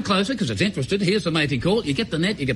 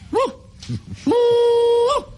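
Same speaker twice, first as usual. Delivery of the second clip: bunyip mating call. Retold or imitated. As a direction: let the call rip again. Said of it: "So I did it again".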